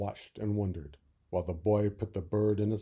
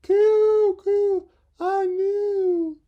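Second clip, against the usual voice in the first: very high, loud cooing; knowing dove; 11 dB louder, much slower than usual. Acted. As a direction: coo proudly like a dove. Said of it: "cried the dove".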